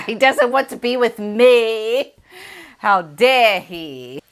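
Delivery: a lightly put-on British royal accent, drawn out slowly on "How dare he?"; mock-indignant British royal accent. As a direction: play an offended British royal, a touch hammed-up. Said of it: "in his very British royal, royal way of saying".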